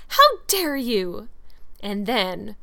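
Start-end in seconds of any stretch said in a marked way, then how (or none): 0.1-1.3 loud, high, drawn-out indignation; passionate outburst. A narrator's reading. Furious girl rounding on the teasing boy, passionately. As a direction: stretch each word in outraged passion. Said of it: "she exclaimed passionately".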